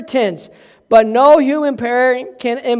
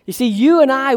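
ordinary delivery

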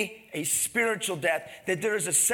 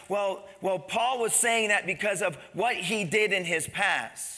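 normal talking voice

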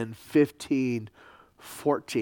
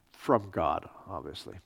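dry, low sarcastic aside; 6 semitones lower, 7 dB quieter than usual. Real, not acted. Lecturer joking about buying a church office, sarcastic. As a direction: drop it away quietly with dry sarcasm. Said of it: "I'm being sarcastic".

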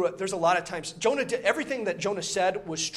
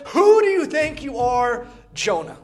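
higher, louder, slow pointed challenge; challenging God; 5 semitones higher, 7 dB louder than usual. Retold or imitated. God challenging Jonah with a raised, slow question. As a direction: demand an answer, slowly. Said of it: "He gives Jonah this last chance".

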